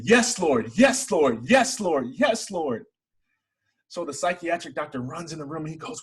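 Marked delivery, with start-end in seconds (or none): 0.0-2.9 higher and louder, repeated cries of Yes, Lord; retold joyful screaming. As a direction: re-enact shouting it out in relief, a bit toned down. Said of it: "I just kept screaming".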